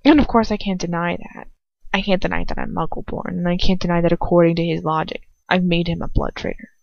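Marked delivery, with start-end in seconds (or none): none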